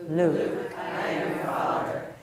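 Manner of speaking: slow, low villain voice; Darth Vader. Shown as a demonstration. Coach leads one last practice, Darth Vader voice. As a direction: intone it from the bottom of your comfortable range. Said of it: "say it again one more time".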